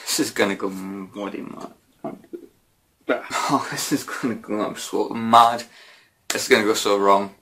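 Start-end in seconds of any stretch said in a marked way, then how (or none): none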